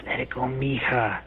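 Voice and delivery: low, soft and breathy, right on the mic; close-mic ghost voice. Shown as a demonstration. Ghost voice caught on the recorder, breathy and close. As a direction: breathe it into the microphone like a spirit. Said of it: "speaking right up against the microphone like this one".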